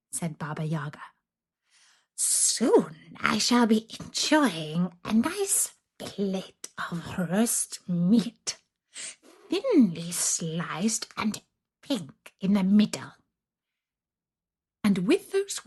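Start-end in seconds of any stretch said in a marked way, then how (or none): 2.2-13.1 slow, whispery hungry hag; gloating witch. Acted. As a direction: savour each word like a meal. Witch voice held.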